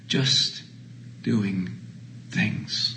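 a hushed, spaced refrain; near whisper. Acted. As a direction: breathe the refrain out.

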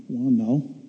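low, soft acted concession; small, reluctant mock admission; sheepish admission. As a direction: give in meekly, voice dropped. Sheepishly conceding to God's challenge.

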